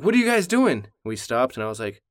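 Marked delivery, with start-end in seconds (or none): 0.0-0.9 put-on high, rising-pitched kid's question; startled child. Son barges in on his parents, startled. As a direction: imitate a kid bursting in, puzzled.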